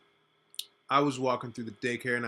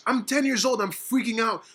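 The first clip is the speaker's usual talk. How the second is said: ordinary talk, nothing marked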